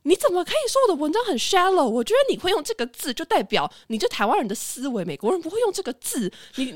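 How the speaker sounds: imitating an irritated teacher's odd accent; a bit annoyed. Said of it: "那个老师听到shallow就有一点生气".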